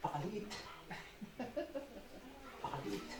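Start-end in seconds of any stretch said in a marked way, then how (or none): 2.6-3.1 very low, soft. Shown as a demonstration. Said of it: "ito lang pala yung problema natin".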